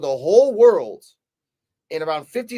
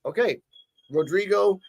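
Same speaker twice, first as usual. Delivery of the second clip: normal talking voice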